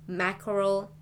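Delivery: ordinary delivery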